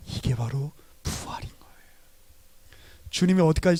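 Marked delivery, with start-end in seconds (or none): 0.1-1.7 soft whispered punchline; preacher's whisper; hushed whisper. Acted. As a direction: whisper it like a secret revealed. Repeated resurrection punchline.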